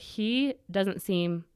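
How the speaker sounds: slow uneasy thought. Retold or imitated. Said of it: "And I was like".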